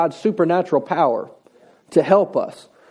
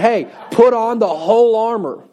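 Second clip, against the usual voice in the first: raised-pitch, emphatic mock yell; imitating God yelling; 5 semitones higher than usual. God yelling at people to obey, mock yell. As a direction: call it out like an all-caps command. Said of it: "kinda like God yelling at us".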